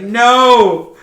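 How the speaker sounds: high, loud cry of no then slow plea; hammed-up despair; desperate protest. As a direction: wail as you are dragged off. Flint dragged away.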